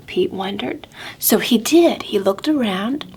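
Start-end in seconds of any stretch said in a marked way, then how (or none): none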